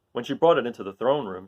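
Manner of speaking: ordinary delivery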